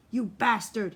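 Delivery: loud snarled insult; angry cry. Acted. Solly crying out at Lute mid-struggle. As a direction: spit the word out in the fight. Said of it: "he cried".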